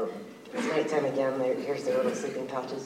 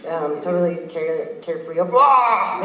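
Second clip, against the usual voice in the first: usual speaking voice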